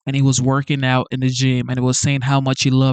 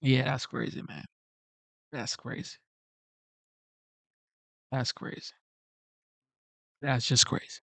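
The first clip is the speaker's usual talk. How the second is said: much softer, trailing off, nearly whispered; moved and quiet; 13 dB quieter than usual. Real, not acted. Streamer moved by the veteran's story, fading murmur. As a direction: murmur it to yourself, shaken.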